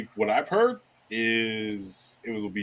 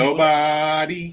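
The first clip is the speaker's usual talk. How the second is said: drawn-out mock; 4 semitones higher, 6 dB louder, much slower than usual. Real, not acted. Mocking the Cowboys' inaction.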